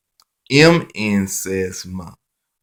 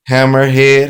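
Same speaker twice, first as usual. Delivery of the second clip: Hammerhead called out higher and louder; called-out keyword; 4 semitones higher, 6 dB louder, faster than usual. Shown as a demonstration. Teacher showing the phonics card, Hammerhead called out loud and high. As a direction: announce the card word Hammerhead loudly and high. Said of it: "phonics card keyword drill".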